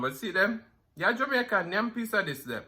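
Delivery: ordinary talk, nothing marked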